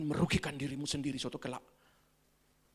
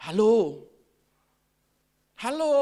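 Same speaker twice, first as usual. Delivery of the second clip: calling out; 4 semitones higher, 8 dB louder, slower than usual. Real, not acted. Calling out to a silent congregation.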